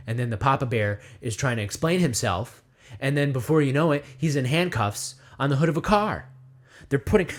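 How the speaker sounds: normal talking voice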